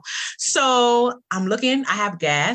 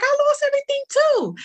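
telling the stranger she shares his story; excited agreement. Retold or imitated. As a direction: jump in, amazed to match. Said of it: "And I'm like".

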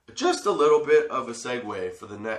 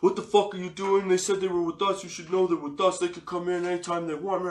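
retold rant in a raised pitch; imitated angry yelling; 6 semitones higher than usual. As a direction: imitate a man berating the guard. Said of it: "He comes out screaming and yelling at me".

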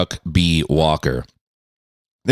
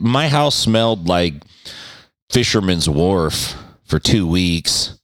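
ordinary talk, nothing marked

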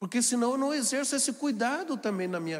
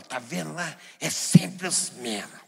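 grumbling complaint; 4 semitones lower, 4 dB louder, slower than usual. Retold or imitated. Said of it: "alguém fala".

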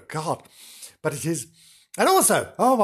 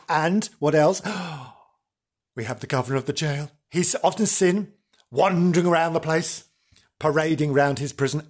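ordinary talk, nothing marked

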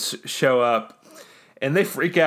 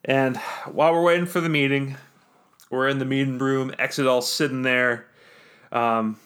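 normal talking voice

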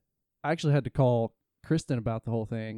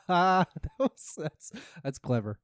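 laughing; 6 semitones higher, slower than usual. Real, not acted. Laughing at the tweet.

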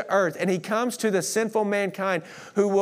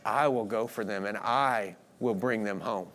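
low, soft and tender; tender Jesus; 7 semitones lower, 5 dB quieter than usual. Acted. Jesus resolving to save the lost, in a low, tender voice. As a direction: speak it like a gentle promise. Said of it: "and he said".

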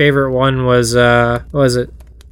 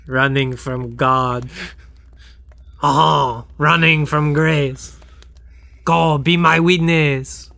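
put-on French accent. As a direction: croon it languidly with a mock French accent. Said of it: "this version of Stars being sung on a stool".

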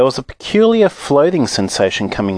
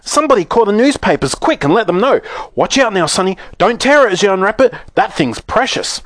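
high, frantic shopkeeper voice; shrieked excitement, gradually coming down; excited yelling; 11 semitones higher than usual. A narrator's reading. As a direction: yell it out, easing slightly into an urgent warning. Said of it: "he yelled".

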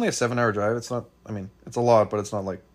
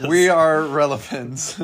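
triumphant shout; 4 semitones higher, 7 dB louder, slower than usual. Real, not acted. Triumphant boast at haters.

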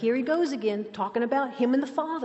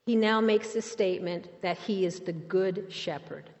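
ordinary talk

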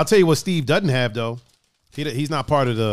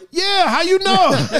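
laughing; 3 dB louder, slower than usual. Retold or imitated. Driver amazed he guessed Lorain.

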